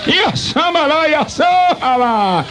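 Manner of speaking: high, stretched-out prayer cry; fervent plea. Real, not acted. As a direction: cry out to God pleading. Pleading prayer outburst. (súplica ferviente, aguda y alargada)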